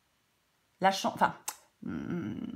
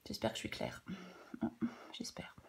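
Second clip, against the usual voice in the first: whispering; 10 semitones lower, 12 dB quieter than usual. Real, not acted. Tarot reader muttering doubt aside.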